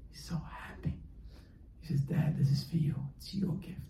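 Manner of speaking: very soft, nearly whispered; hushed tender moment. Acted. Son gives his tearful father the report card, hushed. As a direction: drop to a hush, as if moved.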